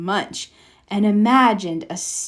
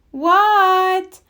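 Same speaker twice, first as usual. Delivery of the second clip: shouted what; 10 semitones higher, 4 dB louder than usual. A narrator's reading. Child answering mother's call.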